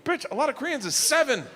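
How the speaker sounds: high, laughing outrage; outraged rant. Retold or imitated. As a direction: squeal it in disbelief. Indignant rant at his wife.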